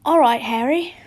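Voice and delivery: a hint of big friendly Hagrid calling out. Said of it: "Hagrid asked".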